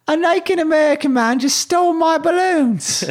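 very high, squeaky British schoolboy; British accent. Acted. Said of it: "I think it was more like".

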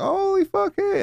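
startled happy greeting; surprised. Retold or imitated. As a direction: recognize her in a flash. Recognizes old friend.

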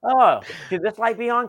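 lightly mocking stagehand voice; mock clueless stagehand. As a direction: play the oblivious crew member flicking the light. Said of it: "Somebody just like".

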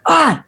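sudden high yelp; short high startled yelp; mock cry of pain. Pressed bruise, imitated high yelp of pain. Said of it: "and it's like".

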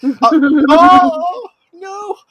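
high whiny wail; high mock despair. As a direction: wail like doom is coming.

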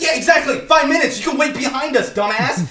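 high, loud mocking retort; retold heated comeback. Him arguing with the mom's friend in line, heated sarcastic retort. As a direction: throw their words back, indignant.

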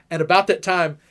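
ordinary talk

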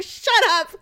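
high mock-embarrassed shut up; laughing it off, mortified. Telling Daryl to hush, high embarrassed retort.

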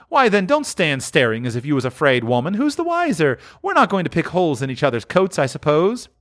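high, fast and bold; brazen woman. Acted. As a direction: justify the theft, hard. Woman defiant.

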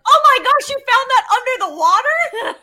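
very high, loud gushing; thrilled amazement. Retold or imitated. Woman reacting to fiance's underwater find, thrilled and amazed. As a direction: squeal it out in wide-eyed wonder.

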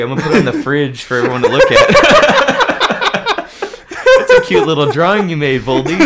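a mock mother praising little Voldy's drawing; mock proud parent. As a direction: coo over the child's drawing. Mocking parent praising Voldemort.

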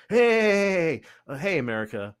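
high, loud, frantic "hey" calls in a mock voice; pleading Germany. Germany begging America not to hand it to the Russians, mock frantic. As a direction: wave America down like a desperate beggar. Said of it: "this story is about Germany, like".

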